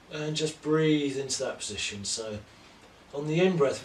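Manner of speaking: calm, measured yoga-teacher cues timed to the breath; guided yoga instruction. Acted. Teacher guides home class through poses, calm paced cues. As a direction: talk the class through each pose breath by breath.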